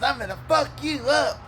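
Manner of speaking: high, loud mocking threat. Truck guy threatening the teller, high and loud.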